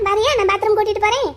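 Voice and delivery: normal talking voice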